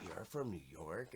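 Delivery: hushed mock awe at a New Yorker; a low, soft imitation of an awestruck out-of-towner; amazed outsider. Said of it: "they're like".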